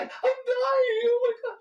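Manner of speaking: high, loud hammed-up crying wail; mock panic. Teacher mocks a panicking self with a loud crying wail. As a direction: wail like the world is ending.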